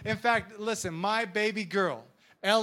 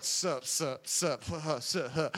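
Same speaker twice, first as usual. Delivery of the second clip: low, soft cool-guy "sup"; too-cool muttered greeting, pitched down; mock proud dummy; 6 semitones lower, 6 dB quieter than usual. Preacher imitating proud people before a blunder, low cocky mumble. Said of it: "people that are real proud".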